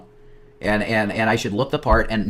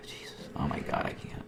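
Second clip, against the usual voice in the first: barely audible, overwhelmed; stunned whisper; 17 dB quieter than usual. Real, not acted. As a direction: whisper it, lost for words. Overwhelmed reaction.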